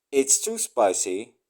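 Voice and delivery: ordinary talk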